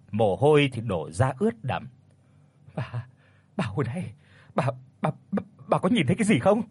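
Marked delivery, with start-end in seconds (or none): 2.7-6.6 stammering, shaken whisper rising; breathless fear. Acted. Shaken old man asks his wife, breathless and scared. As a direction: gasp it out, still terrified. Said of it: "thở không có ra hơi".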